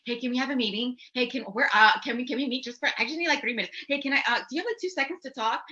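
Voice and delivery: nervously begging for a minute; timid pleading. Retold or imitated.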